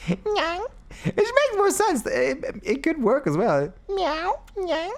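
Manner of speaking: very high, cartoonish cat-talk; high silly voice; squeaky high voice.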